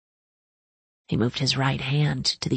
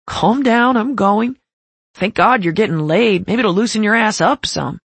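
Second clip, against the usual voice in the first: teasing; 4 semitones higher, 6 dB louder than usual. Acted. Riker laughing it off loudly.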